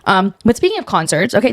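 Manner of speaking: usual speaking voice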